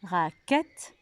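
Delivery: "Raquette" syllable by syllable, rising; split into syllables. Shown as a demonstration. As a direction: separate the last syllable of "Raquette". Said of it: "la syllabe qui est la même".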